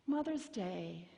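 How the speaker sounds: normal talking voice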